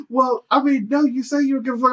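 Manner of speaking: high, fast mock woman's voice; high mock girlfriend. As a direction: squeak it back at him quickly. Girlfriend voiced much higher.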